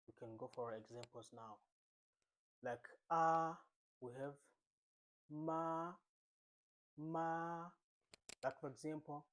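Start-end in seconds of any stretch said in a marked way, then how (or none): 3.1-3.6 AH held high and level; first tone. Shown as a demonstration. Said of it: "the first tone". 5.3-7.7 MA held long on a level high note; first tone. Shown as a demonstration. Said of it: "the first tone".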